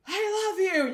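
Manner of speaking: loud, high mock yell of love; yelled declaration. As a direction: blurt it out all in caps. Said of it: "He, like, yells it at you".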